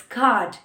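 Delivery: card with long vowel, no R; "card" said British-style, R silent. Shown as a demonstration. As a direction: drop the R in "card". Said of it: "R उनका silent हो जाता है".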